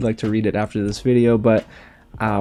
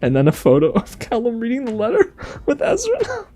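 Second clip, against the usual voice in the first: crying; 11 semitones higher than usual. Real, not acted. Crying after the letter.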